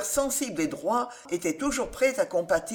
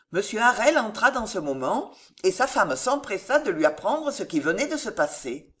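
plain reading voice

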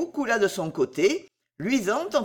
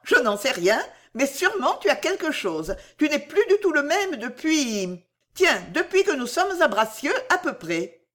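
put-on higher-pitched lively young character; Bijou questionneuse; 7 semitones higher than usual. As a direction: probe with a higher, animated voice. (voix de Bijou, aiguë et insistante)

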